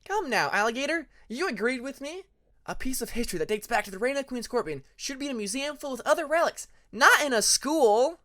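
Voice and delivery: high, lively and insistent; upbeat Hyena. A narrator's reading. Hyena pressing Alligator to agree, high and eager. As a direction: coax her friend with eager conviction.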